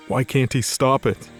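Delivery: ordinary talk, nothing marked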